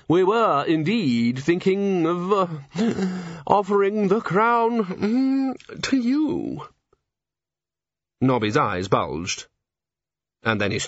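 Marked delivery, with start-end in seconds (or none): 0.1-6.6 slow, higher, silky nobleman; oily posh aristocrat. A narrator's reading. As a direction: coax him with lofty hesitation. Aristocrat offering crown.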